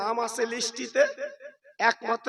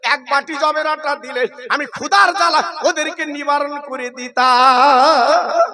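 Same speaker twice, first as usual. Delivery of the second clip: crying out; 4 semitones higher, 12 dB louder than usual. Acted. Fatema pleads to her father for her hungry sons, loud chanted cry. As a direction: wail it out melodically to the father. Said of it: "Fatema চিল্লে বলতে লাগলেন".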